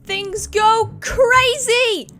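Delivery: high, loud, very drawn-out cry; hyped outburst. Acted. Reviewer warning viewers that things escalate, frantic outburst. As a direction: wail it out, stretching every word. Comic outburst opening next episode.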